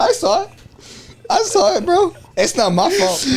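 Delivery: very high, squealed through laughter; helpless laughter. Real, not acted. Telling friends it's not their fault, laughing helplessly. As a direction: protest while laughing to the point of tears.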